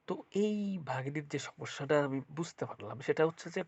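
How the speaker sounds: normal talking voice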